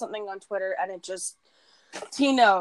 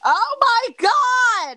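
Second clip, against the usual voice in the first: very high, slow, loud squeal; excited shriek; 7 dB louder, much slower than usual. Real, not acted. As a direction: shriek it out with delight.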